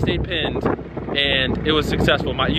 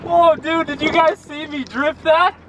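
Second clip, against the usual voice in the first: hyped, squealing high with glee; giddy bro yelling after a great run; frat bro; 11 semitones higher, slower than usual. Real, not acted. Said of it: "I'm like a little kid".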